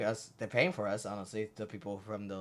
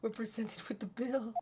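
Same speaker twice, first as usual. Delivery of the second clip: small, high, soft voice; apologetic assistant; 9 semitones higher, 9 dB quieter than usual. Acted. Assistant explains her tears over the bill, apologetic high soft voice. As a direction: confess it softly and high.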